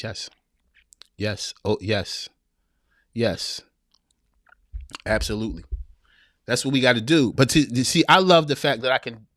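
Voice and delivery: ordinary delivery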